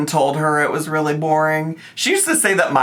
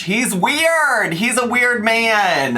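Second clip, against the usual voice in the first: high, indignant cry of disgust; mock outrage; 9 semitones higher, much slower than usual. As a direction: squeal it like you're scandalized. Mocking a man's shirt.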